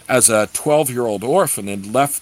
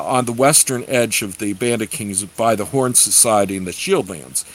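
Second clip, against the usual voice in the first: ordinary delivery, nothing marked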